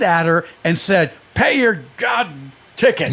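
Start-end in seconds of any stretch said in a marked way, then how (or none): 1.3-3.1 higher-pitched, emphatic mock outburst with a pause; mock exasperated order. Passenger throwing cash at his driver, mock exasperated.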